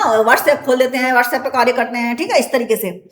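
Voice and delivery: usual speaking voice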